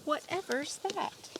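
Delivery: high, slow wondering question; amazed. Acted. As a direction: gasp it out at something new. Mole wonders at the moon.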